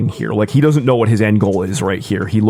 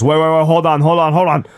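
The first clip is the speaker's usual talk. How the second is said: higher, hurried pleas to stop; alarmed pleading; 6 semitones higher, much faster than usual. Acted. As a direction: beg him to wait, panicky. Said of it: "And Mateo goes".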